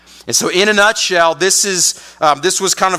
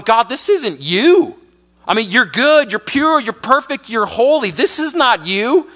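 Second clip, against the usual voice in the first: disbelieving prophet; 6 semitones higher than usual. Retold or imitated. Habakkuk pleading with God in disbelief, voice high. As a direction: insist this can't be God. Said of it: "he's going".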